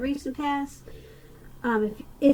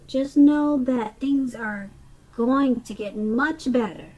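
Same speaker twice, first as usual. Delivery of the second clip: normal talking voice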